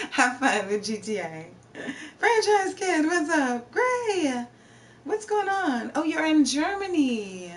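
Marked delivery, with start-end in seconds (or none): 2.2-7.5 bright, much higher greeting to chat; high-pitched hello to each new viewer; excited greeting. Real, not acted. Streamer greeting viewers in chat, excited and high.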